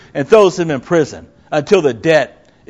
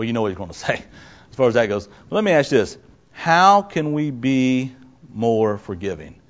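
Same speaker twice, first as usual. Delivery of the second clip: ordinary talk, nothing marked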